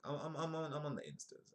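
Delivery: high-pitched stammering put-on voice; mock boast. Host joking about being on the Instas, high mock boast.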